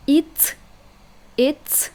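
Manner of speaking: normal talking voice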